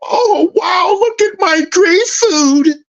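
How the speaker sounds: high, slow gushing phone-poser voice; high excited mock influencer squeal; mocking selfie poser. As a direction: gush over your plate like a show-off. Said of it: "taking pictures of their food".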